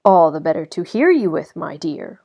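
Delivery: a hint of a wolf toying with prey; low silky voice. A narrator's reading. Wolf answers the girl's worry, lightly silky. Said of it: "replied the low, silky voice".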